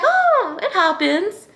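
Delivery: high, light, careless tone; breezy shrug. Retold or imitated. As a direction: brush it off like it's nothing. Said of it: "And I was like".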